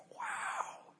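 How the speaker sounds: breathy high awed wow. Retold or imitated. Diner stunned by the cake, a hushed high wow. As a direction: let the taste stun you into a hushed wow.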